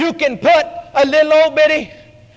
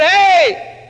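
calling out; 4 semitones higher than usual. Acted. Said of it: "he addressed him and said".

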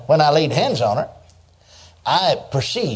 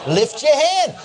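calling out to the crowd; 12 semitones higher, slower than usual. Retold or imitated. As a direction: sing it out over the crowd like the evangelist.

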